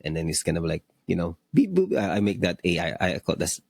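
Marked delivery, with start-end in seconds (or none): 1.5-2.0 high-pitched robot beeps. Pretend. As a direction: chirp it like a little robot. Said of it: "my A I sounds like beep boop".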